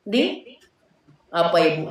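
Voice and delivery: ordinary delivery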